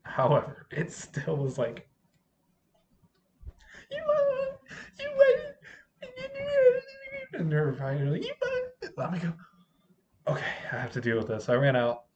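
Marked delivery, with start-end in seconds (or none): 3.9-8.9 put-on high squeaky girl voice. Girls squaring off.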